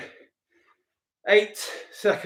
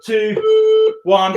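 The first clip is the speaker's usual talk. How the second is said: shouted countdown; 5 dB louder than usual. Real, not acted. Counting down the last seconds.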